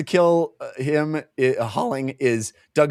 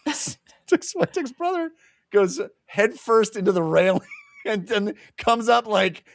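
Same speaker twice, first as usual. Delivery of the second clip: laughing while telling; 8 semitones higher than usual. Real, not acted. Laughing while retelling the fall.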